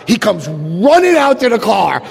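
usual speaking voice

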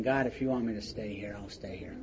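soft, near-whispered prayer, fading out; praying under the breath to God; quiet prayer. Retold or imitated. A man in a jail cell praying quietly to God. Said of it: "I got real quiet".

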